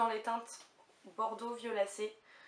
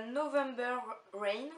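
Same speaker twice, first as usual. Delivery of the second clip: English accent; 3 semitones higher, 4 dB louder, slower than usual. Shown as a demonstration. French beauty vlogger naming her favourite candle, in a slow English accent. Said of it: "Désolée pour mon accent anglais".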